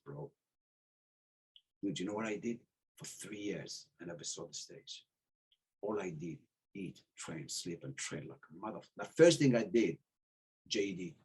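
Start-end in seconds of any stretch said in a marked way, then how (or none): none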